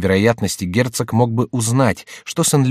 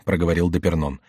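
ordinary delivery

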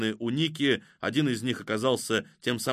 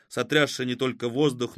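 usual speaking voice

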